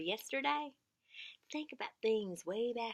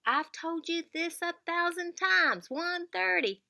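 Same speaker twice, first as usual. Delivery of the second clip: higher, louder, slow exasperation; exasperated; 8 semitones higher, 5 dB louder than usual. Shown as a demonstration. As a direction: show you're fed up telling them. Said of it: "Never say".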